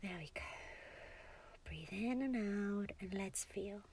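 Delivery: soft, whispery breathing guide; calming guidance. Acted. Guides partner through calming breathing.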